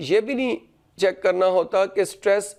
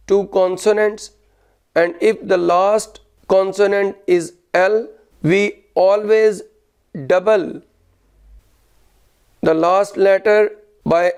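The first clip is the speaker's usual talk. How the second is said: slow, louder dictation, phrase by phrase; teacher dictating a rule louder, pausing after each phrase; 4 dB louder, much slower than usual. Shown as a demonstration. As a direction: dictate the rule loudly and slowly for the class to copy. Dictates next rule to students.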